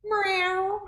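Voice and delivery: high playful cat meow. Shown as a demonstration. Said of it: "let's make a sound like a cat".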